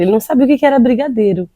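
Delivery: ordinary talk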